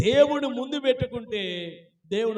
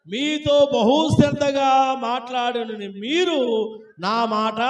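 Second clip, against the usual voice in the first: very slow, drawn-out Bible reading; scripture reading; 6 semitones higher, slower than usual. Real, not acted. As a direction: read word by word, stretching each phrase. Reads out scripture verse. (నిదానంగా సాగదీస్తూ వాక్య పఠనం)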